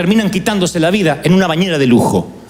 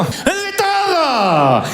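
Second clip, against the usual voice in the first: higher, slow drawn-out exclaim; triumphant aunt; 8 semitones higher, much slower than usual. Retold or imitated. Aunt proudly reporting her test results, higher slow exclaim. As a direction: proudly announce it like a trophy.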